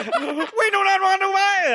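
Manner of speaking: very high, loud, slow squeal through laughter; full Kermit the Frog. Pretend. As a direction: go all in on the frog. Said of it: "just go full Kermit the Frog".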